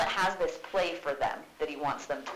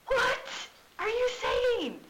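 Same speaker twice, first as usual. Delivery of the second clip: much higher, shocked squeak; shocked Ophelia; 4 dB louder, slower than usual. Retold or imitated. Teacher voicing Ophelia, shocked at Hamlet's crude remark. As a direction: gasp it out in disbelief.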